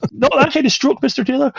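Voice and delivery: high-pitched put-on telling-off; mock doctor's correction. Doctor to Mister Taylor about the stroke, mock correction. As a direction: correct the patient in a comic high voice.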